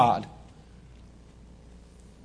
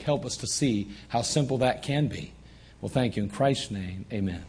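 softer, hushed prayer to God; quiet prayer; 8 semitones lower, 8 dB quieter than usual. Real, not acted. Pastor leading the congregation in a soft closing prayer. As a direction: pray it softly, humbly.

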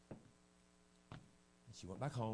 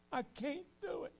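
tearful refusal; slower than usual. Retold or imitated. Preacher imitating the girl's tearful refusal, high and slow. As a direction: voice jumps much higher, slow and pleading. Girl refusing to forgive, retold.

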